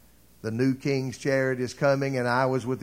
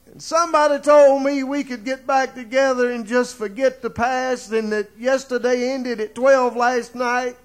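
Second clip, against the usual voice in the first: higher and louder, rising to a climax; building preaching; 8 semitones higher, 6 dB louder than usual. Acted. Preacher stirs the congregation with a loud, high rising climax. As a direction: drive the congregation toward hope.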